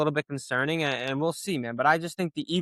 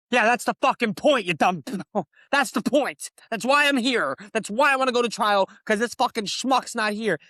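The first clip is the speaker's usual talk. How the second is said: retold outburst; 7 semitones higher than usual. Defendant retelling his angry reply to the district attorney. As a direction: tell her off in the retelling. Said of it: "I'm like".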